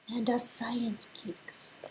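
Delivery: slow, soft whispered aside. Acted. Adds her own group in a slow whisper. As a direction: slip it in under your breath, slowly.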